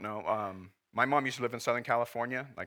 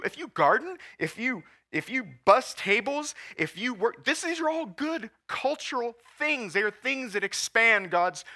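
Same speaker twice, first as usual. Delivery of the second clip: fiery preaching; 10 semitones higher, 7 dB louder than usual. Acted. Pastor urging congregation about everyday work, fervent preaching. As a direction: preach it out to the back row.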